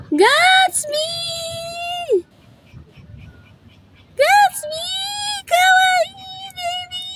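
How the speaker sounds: high-pitched calling the dog. Real, not acted. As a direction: call out high and bright.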